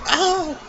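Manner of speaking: sudden high-pitched squeal. Real, not acted. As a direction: squeal in surprise. Squeal after chipmunk remark.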